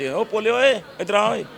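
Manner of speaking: high-pitched village call; acted holler to a pal. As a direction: hail someone over from a distance. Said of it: "ਕੋਈ ਮਰਜ਼ੀ ਨੂੰ ਆਵਾਜ਼".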